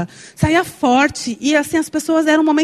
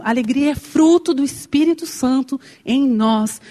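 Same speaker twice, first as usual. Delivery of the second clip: normal talking voice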